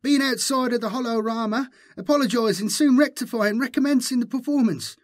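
very high, fast, fawning gabble; high-pitched, hurried grovelling; babbling herald. A narrator's reading. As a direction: babble excuses to a master in a squeaky voice. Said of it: "babbled the herald".

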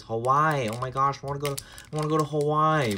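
quick mock-excited would-be tourist; lightly put-on voice of everybody saying Hawaii; gushing tourist imitation. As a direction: imitate an eager tourist, rushing the words. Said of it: "Like, everybody says".

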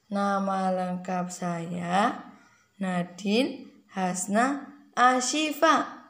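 slow, drawn-out introduction to copy; slow demo for a child. Shown as a demonstration. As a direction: lay out each word slowly for her. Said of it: "Nadine kalau baca gimana".

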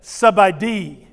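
slow Sabai di, stress on Sabai falling; Thai reply. Shown as a demonstration. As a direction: answer in Thai, slowly. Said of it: "Let me hear you say that".

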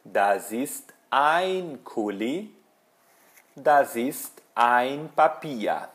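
slow, distinct German, word by word; deliberate teacher's example, stretching "ein"; model sentences. Shown as a demonstration. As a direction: spell out each sentence slowly for learners. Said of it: "eu posso dizer".

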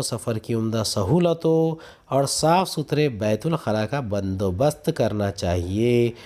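ordinary talk, nothing marked

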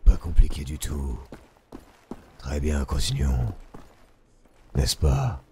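low, gruff American action hero; a hint of a gravel-voiced game hero; deep video game hero voice. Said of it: "une voix de héros de jeu vidéo américain".

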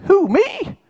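very high squeak of disbelief; squeaky. Acted. Preacher acts Gideon hiding from his calling, squeaky. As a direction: squeak it out, voice leaping right up. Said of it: "Can't you just squeak a little bit?".

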